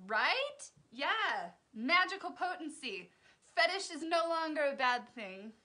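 loud, high, emphatic glee; gleeful amazement. Real, not acted. Vlogger announces her new takeaway to viewers, gleeful amazement. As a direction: proclaim the silly discovery at high volume. Delighted by the surprising definition.

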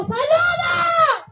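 high, long held shout; slow, high-pitched holler; drawn-out calling out. Real, not acted. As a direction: stretch the word in a high call to the whole crowd. Calling everyone to wave.

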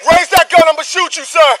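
sharp commanding yell at a gunman; real shouted command. Real, not acted. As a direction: bark the order to drop the gun. Officer confronting armed man.

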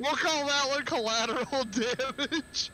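high-pitched, laughing through the words. Real, not acted. Joking with friends about a bombed ship, laughing. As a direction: let the laugh break into the line.

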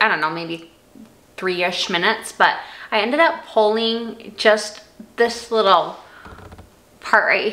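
talking with strips on; slight lisp through strips; whitening strips on teeth. Real, not acted. Seen 1: Applies strips at -12; thin film on teeth while talking.

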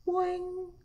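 high springy boing; cartoon spring noise, voiced; imitated boing. Shown as a demonstration. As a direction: bounce it out on a high held note. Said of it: "Machine noises".

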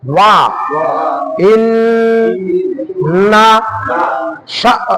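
very slow, each syllable pulled apart. Shown as a demonstration. Teacher sounding out syllables for the student, very slowly. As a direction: read it out one syllable at a time. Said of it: "একটা বা দুইটা করে উচ্চারণ করব".

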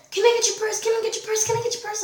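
high, rapid-fire mock pestering; mock begging. Classmate pesters her for a purse, mock begging. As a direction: imitate a nagging classmate in a high voice.

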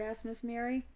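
ordinary delivery

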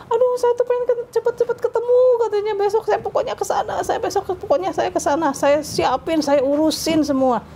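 a cousin crying for joy on the phone, high and tearful. Retold or imitated. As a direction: choke up with happiness, then calm. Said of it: "Wah, sampai nangis gitu".

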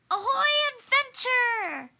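loud, drawn-out cheer; excited call. Acted. Narrator voicing a character hailing the sea, loud slow cheer. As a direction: belt it out like a pirate greeting.

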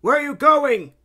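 high, loud call. A narrator's reading. Calling to Princess Kitty as she gets out, calling out. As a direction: call after someone leaving. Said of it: "as he calls out".

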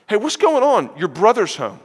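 a servant blurting it out on the run; excited servant. Retold or imitated. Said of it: "imagine, like, a servant running by".

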